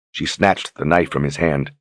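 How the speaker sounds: usual speaking voice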